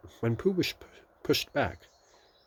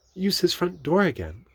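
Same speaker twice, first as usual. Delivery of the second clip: Christopher Robin; 12 semitones higher, 7 dB louder than usual. A narrator's reading.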